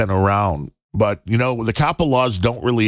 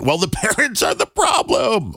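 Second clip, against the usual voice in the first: laughing; 10 semitones higher than usual. Real, not acted. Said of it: "I'm laughing so hard".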